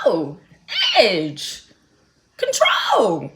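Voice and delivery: high, loud word-by-word yell; hammed-up cry for edge control; mock shout. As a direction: shout each word like a slogan. Acted outburst about edge control.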